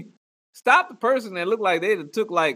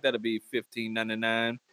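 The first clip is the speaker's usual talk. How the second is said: mock cashier; 10 semitones lower, 10 dB quieter than usual. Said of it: "they be like".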